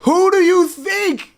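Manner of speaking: hammed-up outrage; mock outraged yell. Mocking homeowner on phone.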